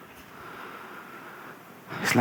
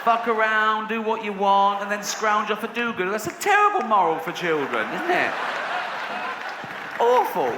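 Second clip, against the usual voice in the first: raised-pitch indignant rant; mock outrage; 6 semitones higher than usual. Comedian condemning a children's fable to the audience, indignant. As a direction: rail against it in comic disgust.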